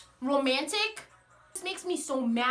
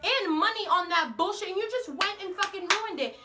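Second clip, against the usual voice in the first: mock outraged; 7 semitones higher than usual. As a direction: scold her like she wrecked your gift, voice pitched way up. Outraged at character ruining teddy.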